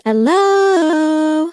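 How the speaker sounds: robotic pitch-corrected hello; auto-tuned. Shown as a demonstration. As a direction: sing it through auto-tune. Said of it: "You have auto-tune options".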